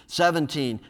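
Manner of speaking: ordinary talk, nothing marked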